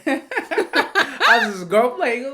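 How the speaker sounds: high loud laugh through the words; amused laughing line. Real, not acted. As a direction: laugh it out slowly. Laughing mock.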